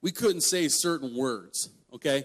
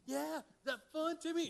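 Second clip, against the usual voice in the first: put-on high-pitched girls' heckle from a distance; mock taunting; 12 semitones higher, 12 dB quieter than usual. Neighbor girls picking on the brother pulling weeds, mock taunting. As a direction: imitate girls teasing, pitch way up. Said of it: "they were just going".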